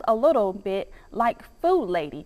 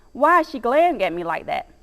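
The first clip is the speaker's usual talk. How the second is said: ordinary delivery, nothing marked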